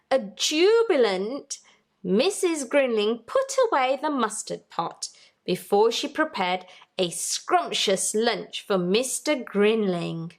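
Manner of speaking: ordinary delivery, nothing marked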